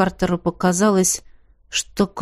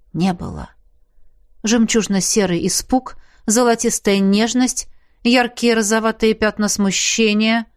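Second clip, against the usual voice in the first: ordinary delivery, nothing marked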